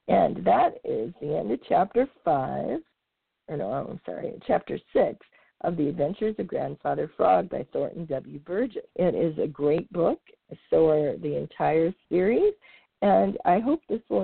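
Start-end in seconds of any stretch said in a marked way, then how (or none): none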